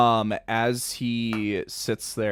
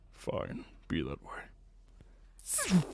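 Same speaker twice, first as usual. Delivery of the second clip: pouty sulk; 8 dB quieter, much slower than usual. Acted. Hebrus pouts at rejection.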